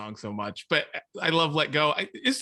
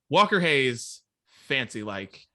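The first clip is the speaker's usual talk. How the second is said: ordinary delivery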